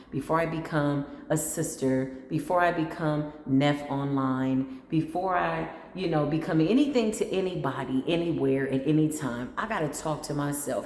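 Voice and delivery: usual speaking voice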